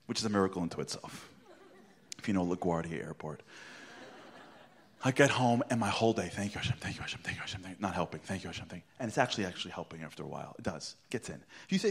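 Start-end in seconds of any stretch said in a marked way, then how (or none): 6.3-8.9 soft, whispered, repeated thanks; muttered mantra. Retold or imitated. He repeats thanks to Hashem all day, whispered. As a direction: mumble the thanks over and over.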